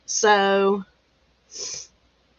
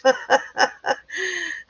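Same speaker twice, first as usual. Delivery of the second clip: high breathy laugh; a real burst of laughter; 9 semitones higher, 3 dB quieter than usual. Real, not acted. Vlogger cracking up at the news, high laugh. Reacting to tractor chase story.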